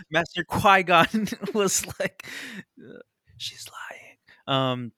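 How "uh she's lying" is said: whisper. Retold or imitated.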